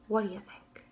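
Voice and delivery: hushed whispered question. Shown as a demonstration. Teacher whispers question to kids.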